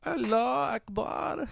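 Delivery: high, slow put-on Middle Eastern accent. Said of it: "Middle Eastern jihadist accent?".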